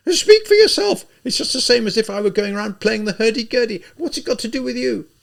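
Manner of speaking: very high, loud shrill defiance; indignant retort. A narrator's reading. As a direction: fling it back at the policeman. Katerina retorts.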